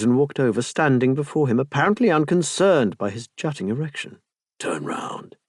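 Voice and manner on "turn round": low whispered command; a hushed, lowered whisper; whispered order. Acted. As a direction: breathe the order. Quiet command during seduction.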